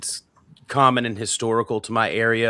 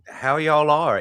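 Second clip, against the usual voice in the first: raised-pitch South Carolina drawl; South Carolina accent; 5 semitones higher, 3 dB louder, slower than usual. Shown as a demonstration. Showing a greeting still heard in South Carolina, South Carolina drawl. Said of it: "still around in parts of South Carolina".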